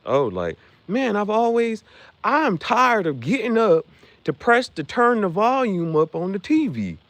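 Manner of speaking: whiny customer. Retold or imitated. Pitching a product, a whiny customer complains. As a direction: whine like a lazy TV watcher.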